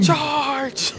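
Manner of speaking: high, drawn-out charge call; playful high rallying yell; battle cry. Pretend. Mock battle cry in game.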